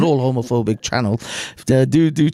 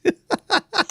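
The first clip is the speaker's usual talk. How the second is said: much higher giggling laugh; 4 dB quieter than usual. Real, not acted. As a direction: squeal with laughter. Laugh at Zubida.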